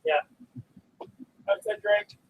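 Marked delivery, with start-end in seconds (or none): 1.5-2.2 muffled voice inside a helmet. Real, not acted. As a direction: talk from under a helmet. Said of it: "Need a straw right to the middle".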